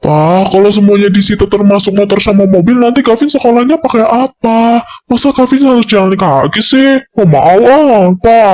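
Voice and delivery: put-on high, pleading teenage voice; spoiled teen whining to his father; whiny teen son. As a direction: beg your dad like a spoiled kid.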